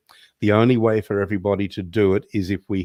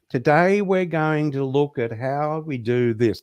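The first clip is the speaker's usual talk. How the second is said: slow, dull presenter drone, a bit higher; mock boring video presenter; 5 semitones higher, slower than usual. Mocking a boring training video, slow dull drone. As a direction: send up a tedious training video host. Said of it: "not one of those horrible videos".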